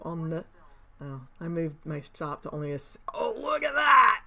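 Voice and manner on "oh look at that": sudden high, loud exclamation; loud, much higher startled cry; surprised shout. Real, not acted. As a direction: blurt it out in shock at the chart. Price suddenly moves on screen.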